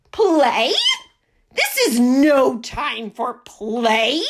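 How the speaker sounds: loud indignant yell, slow on the last words. A narrator's reading. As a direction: belt it out, outraged. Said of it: "yelled Duck".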